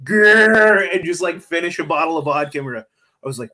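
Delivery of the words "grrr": high, loud growl after a big gulp; mock growl. McAfee after a glass of vodka, loud growl. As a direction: growl like a wild man downing vodka. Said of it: "he'd be like".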